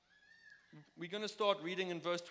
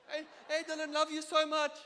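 imitating mom; 8 semitones higher, 7 dB louder than usual. Mom's Instagram greeting quoted.

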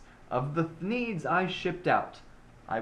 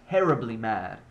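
ordinary delivery

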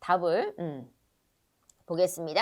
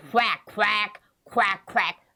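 evenly spaced, punchy quacks; duck quack imitation; 2 semitones lower, much slower than usual. Teacher showing a duck to kids, duck imitation.

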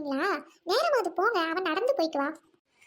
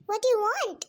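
normal talking voice

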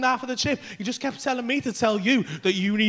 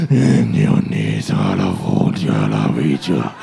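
very low, slow possessed gibberish; deep monstrous possessed chanting; demon voice; 3 dB louder, slower than usual. Retold or imitated. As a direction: growl drawn-out tongues like a demon. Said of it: "Demon voice is gonna eat me".

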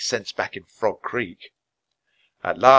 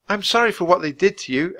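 ordinary delivery

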